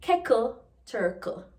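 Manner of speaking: "Keckle, turkle" with a K sound in place of the TT; patois kettle and turtle. Shown as a demonstration. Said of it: "kettle or turtle becomes K L E".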